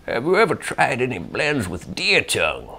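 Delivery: lightly voiced old man. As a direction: read it as an elderly man. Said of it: "He's requesting an old man voice".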